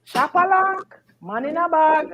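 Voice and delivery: imitated high, repeated cry; high-pitched mock broken-English call; Jamaican Chinese man. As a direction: chant it like the man in the bag, phrase by phrase. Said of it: "So him keep saying".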